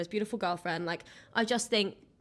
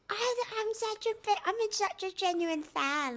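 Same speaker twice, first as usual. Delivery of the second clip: mock fangirl; 11 semitones higher, 3 dB quieter than usual. Mocking own fangirl self.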